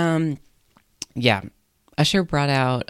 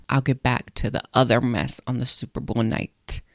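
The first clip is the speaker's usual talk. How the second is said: usual speaking voice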